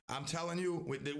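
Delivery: ordinary delivery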